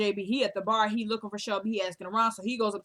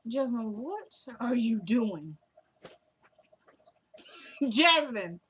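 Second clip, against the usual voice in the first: real scolding; much slower than usual. Real, not acted. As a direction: break off and call out the culprit. Breaks off to scold Jasmine.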